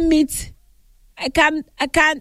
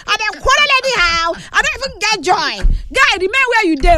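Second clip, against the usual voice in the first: high-pitched, worked-up complaint; high, excited scolding; worked-up shouting; 9 semitones higher than usual. Real, not acted. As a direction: rant shrilly at the caller, pitched way up. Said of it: "They make me dey shout anyhow".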